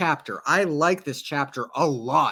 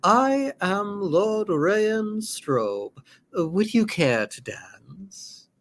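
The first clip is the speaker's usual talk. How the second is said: put-on slow, formal young lord introducing himself; courtly suitor; 4 semitones higher, much slower than usual. Young lord asking Lady Valette to dance, slow and courtly. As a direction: draw it out, a gallant invitation to dance.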